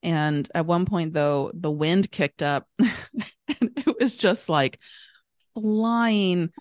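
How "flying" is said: normal talking voice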